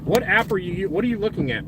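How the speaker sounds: normal talking voice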